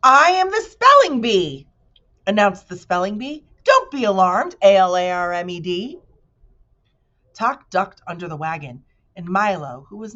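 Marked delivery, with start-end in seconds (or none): 0.0-1.7 high, loud, grand bee voice; proud spelling bee. Acted. Bee character voice. 3.6-6.0 proud spelling bee. Acted. Bee character voice.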